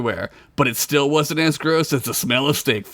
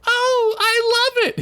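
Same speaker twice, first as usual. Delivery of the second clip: very high, sweet wife voice; slower than usual. Acted. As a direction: greet him like a doting wife. Wife delighted.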